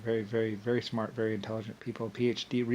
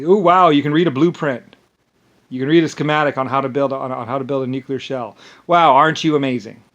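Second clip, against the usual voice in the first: sarcastic mock praise; 2 semitones higher, 11 dB louder than usual. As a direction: gush with scorn, overdo the wonder. Mocking sarcastic praise of enemy.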